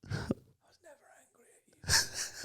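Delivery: faint whispery dying father; deathbed voice. Pretend. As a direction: whisper from a deathbed, barely there. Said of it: "I'll do an impression of that now".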